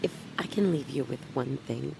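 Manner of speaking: ordinary delivery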